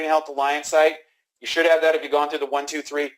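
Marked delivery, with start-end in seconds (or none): none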